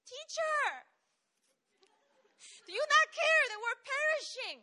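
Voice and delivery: high, loud frightened cry; panicked plea. Acted. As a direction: plead in terror with the teacher. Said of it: "Book of Mark".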